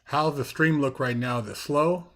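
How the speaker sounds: usual speaking voice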